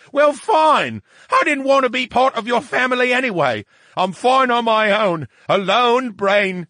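high, loud wounded sulking; sulky brain voice. Acted. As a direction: pout and protest loudly. Brain sulks about exclusion.

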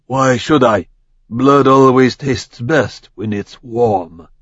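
lightly voiced relish, slow on the last words; Raden, menacing shinobi. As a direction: savor the thought of blood. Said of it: "By his tone and the way he licked his fangs".

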